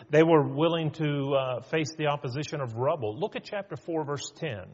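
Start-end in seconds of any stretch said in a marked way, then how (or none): none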